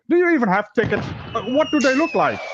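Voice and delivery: lightly acted incredulous officers, pitched high; high-voiced mimicry of baffled officers; officers' mock question. Acts out officers questioning the man.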